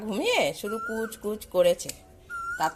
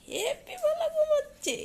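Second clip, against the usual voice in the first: much higher squeaky pet voice; voicing the dog; 4 dB louder than usual. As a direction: answer as the dog in a tiny cutesy voice. Answering for the sleepy dog. (কুকুরের হয়ে খুব উঁচু মিহি গলায় বলা)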